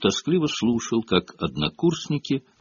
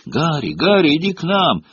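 put-on high-pitched boyish summons; eager calling out; 6 semitones higher than usual. A narrator's reading. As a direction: call a friend over keenly, pitched up. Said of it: "позвал Колин Криви".

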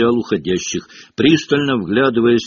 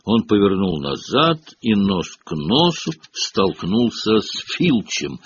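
usual speaking voice

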